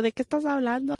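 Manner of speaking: mock confusion at mom's words; confused teen. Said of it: "Y yo".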